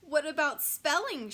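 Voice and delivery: eager mock shout. A narrator's reading. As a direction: call it out to the teacher. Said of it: "shouts Randy Potts".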